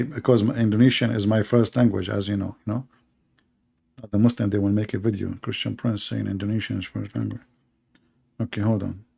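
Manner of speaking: a quiet aside away from the mic; muttered aside. Real, not acted. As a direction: mumble it off-mic while busy. Muttered aside while fetching a clip.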